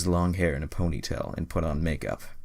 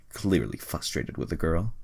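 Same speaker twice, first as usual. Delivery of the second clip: ordinary delivery, nothing marked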